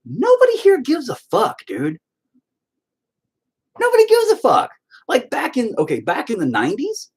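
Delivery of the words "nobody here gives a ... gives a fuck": retold outburst, pitched way up; exasperated yell. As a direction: blow up at him in disbelief. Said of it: "And I'm all".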